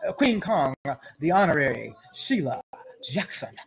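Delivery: ordinary talk, nothing marked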